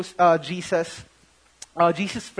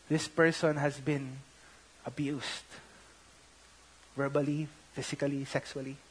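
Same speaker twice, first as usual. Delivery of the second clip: hushed telling; 6 semitones lower, 11 dB quieter than usual. Real, not acted. Telling of counselee's abuse, hushed.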